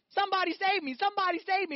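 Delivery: high, urgent cries for help; pretend panicked yell. Preacher voicing a drowning listener, panicked yell. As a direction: call out like you're being swept away. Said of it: "You're screaming".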